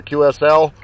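ordinary talk

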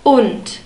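Und ending in a crisp T; D spoken as T. Shown as a demonstration. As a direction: say Und as "oont". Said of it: "like a T again".

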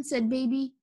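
usual speaking voice

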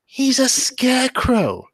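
high, slow incredulous voice. Retold or imitated. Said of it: "I'm thinking to myself".

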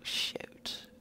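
breathy whispered shoot; whispered curse. Real, not acted. Gamer after a mishap, whispered curse. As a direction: let the curse slip out under your breath.

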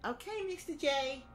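put-on high Brooklyn-accented Harley Quinn; a man doing a squeaky Brooklyn Harley. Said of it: "It's me, Harley Quinn".

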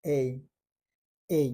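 "এই" said clearly as the English letter A, a long "ay"; correct letter name A. Shown as a demonstration. তিনটা letter শেখাচ্ছেন শিক্ষক, A উচ্চারণ করে দেখান: slow, clear "এই". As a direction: model the letter A as "এই", not "এ". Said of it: "এটার উচ্চারণ হচ্ছে".

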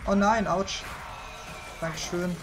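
normal talking voice

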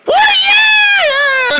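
very high, drawn-out squeal; hammed-up party cry; excited cheer. Crowd cheers the dance party, squealed cheer.

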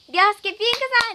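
loud, high announcer call of each rank; ranking announcement. Acted. Streamer to viewers, announcing the gift ranking loudly. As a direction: call out the ranks like a show host.

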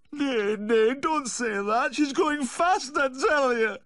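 high, loud, wailing protest; distraught cry for help; desperate pleading. Acted. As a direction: plead frantically, drawing out the words.

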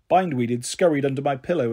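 ordinary delivery

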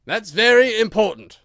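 high, very loud declaration; booming royal verdict; emphatic pronouncement. Acted. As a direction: announce it weightily to the jury. King pronounces to the jury.

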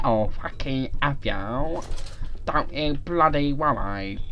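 slow, lightly hammed Scottish; mock Scottish accent. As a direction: keep the Scottish put-on going. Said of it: "You fucking Scottish".

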